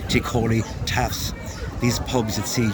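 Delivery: ordinary delivery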